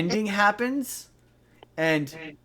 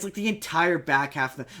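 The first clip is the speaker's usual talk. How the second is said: ordinary delivery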